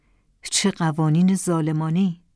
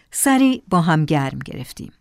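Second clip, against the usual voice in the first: usual speaking voice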